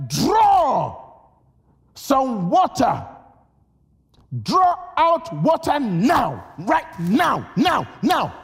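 booming command, drawn out; commanding shout. Acted. Preacher shouting Jesus' command at servants, booming. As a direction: thunder the order to draw water.

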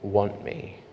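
slow, hushed, spaced-out question; soft divine voice. Retold or imitated. Voice of God speaking in the stillness, slow and hushed. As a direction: ask it very softly, word by word. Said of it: "I hear this voice say to me".

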